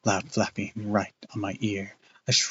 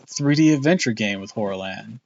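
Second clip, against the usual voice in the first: ordinary delivery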